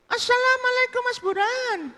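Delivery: very high falsetto call; veiled woman's greeting. Acted. Veiled woman greeting Burhan, high falsetto. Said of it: "Jadi pada waktu ditutup gini".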